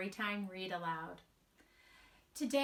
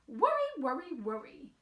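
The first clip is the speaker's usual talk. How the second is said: grandmother voice; 4 semitones higher, 6 dB louder than usual. Acted. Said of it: "said her grandmother".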